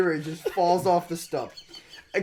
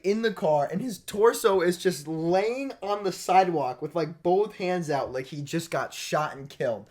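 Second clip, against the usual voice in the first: usual speaking voice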